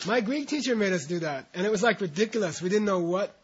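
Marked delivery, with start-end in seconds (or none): none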